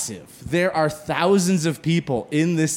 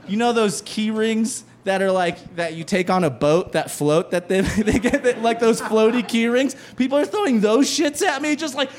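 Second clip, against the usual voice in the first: usual speaking voice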